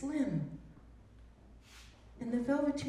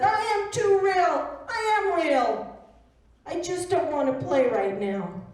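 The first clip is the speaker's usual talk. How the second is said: hurt protest; 5 semitones higher, 7 dB louder than usual. Acted. Velveteen Rabbit protesting to the bunny, high and tearful. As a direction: insist you're real, close to crying.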